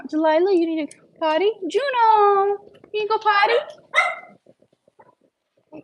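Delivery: high coaxing pet voice; talking to a dog. Real, not acted. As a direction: coax the puppy, high and sweet. Calling dogs to potty.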